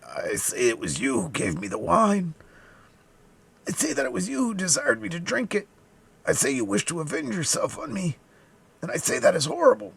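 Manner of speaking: high, strained accusation; agonized dying man. A narrator's reading. Dying man blaming D'Artagnan for the wine, high agonized voice. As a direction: press the accusation in agony. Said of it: "a dying man were distorted with agony".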